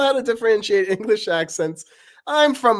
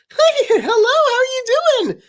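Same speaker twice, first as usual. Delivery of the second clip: very high, loud giggly greeting; Paper's voice; 10 dB louder, faster than usual. Acted. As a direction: squeak hello as Paper. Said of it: "Paper and Knife talking".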